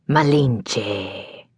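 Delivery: soft, spiteful hiss; vindictive hiss. Acted. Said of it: "was a vindictive hiss".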